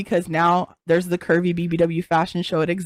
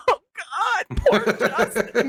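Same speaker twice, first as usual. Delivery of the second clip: high, tearful wail breaking into laughter; hammed-up weeping over nothing, drawn out; mock crying; 7 semitones higher, slower than usual. Woman imitating herself weeping over celebrity news, mock crying. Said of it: "I just cry for no reason".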